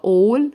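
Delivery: 'all' with A sounded as O; loud, clear 'all' opening on a long 'aw'; modelled pronunciation. Shown as a demonstration. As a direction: stress 'all' as a teacher's model. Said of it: "ال A لما يجي وراها L بنطقها O".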